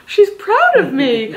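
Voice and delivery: high-pitched, slow retold awe with a laugh; moved, retold. Shop owner retelling her reaction to praise, moved. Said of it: "I had tears in my eyes".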